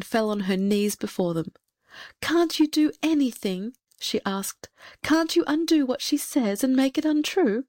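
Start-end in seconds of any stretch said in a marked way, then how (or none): 2.2-3.7 higher-pitched plea from a distraught mother; pleading. A narrator's reading. Poor queen on her knees to the fairies, pleading. 5.0-7.6 high, urgent plea; pleading. A narrator's reading. Desperate queen asks the fairies to undo a curse, pleading.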